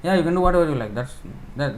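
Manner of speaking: ordinary talk, nothing marked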